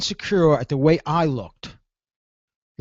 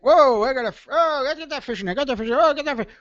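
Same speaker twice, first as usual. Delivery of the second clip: high, eager, reeling it in; hyped whoa-I-got-one fisherman voice; excited angler; 7 semitones higher, faster than usual. Retold or imitated. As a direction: play a thrilled fisherman with a bite on the line. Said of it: "you're fishing and you get".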